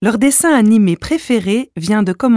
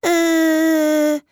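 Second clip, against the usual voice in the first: timid little letter; 9 semitones higher than usual. A narrator's reading.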